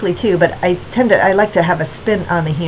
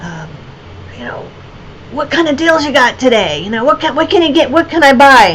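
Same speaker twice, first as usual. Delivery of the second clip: higher, lightly voiced pushy bargain hunter; tipsy customer; 4 semitones higher than usual. Tipsy festival customer haggling with the artist, mock pushy. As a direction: imitate a drunk guy angling for a deal. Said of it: "and he's kind of like".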